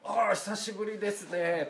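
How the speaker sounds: drawn-out, high-pitched polite hello; put-on high, deferential greeting. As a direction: greet a peer with slow, formal politeness. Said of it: "敬語で".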